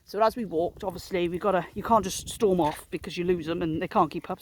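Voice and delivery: ordinary talk, nothing marked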